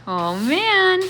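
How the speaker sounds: awed at the open kitchen; amazed. Real, not acted. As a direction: gasp it in wonder.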